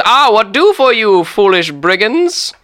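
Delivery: put-on minimum-effort captain accent, high and loud; Christopher Columbus. Captain greets prisoners in his office, high hammed-up put-on accent. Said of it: "minimum effort is what this accent".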